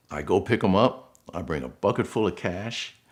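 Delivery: normal talking voice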